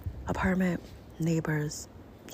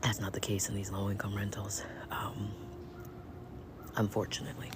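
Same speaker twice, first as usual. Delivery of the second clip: whispering; 6 semitones lower, 10 dB quieter than usual. Real, not acted. Aside about low-income rentals.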